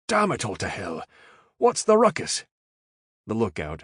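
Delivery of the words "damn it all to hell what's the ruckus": high, loud Irish outburst; startled curse. A narrator's reading. Caleb startled into spilling nitro, high angry Irish curse. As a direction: swear at the sudden noise.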